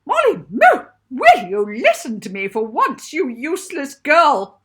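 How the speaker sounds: very high squeaky voice, sneering; squeaky teacher voice. A narrator's reading. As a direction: squeak it out like a shrill spiteful teacher. Scolding teacher voiced in story.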